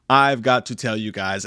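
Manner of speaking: ordinary talk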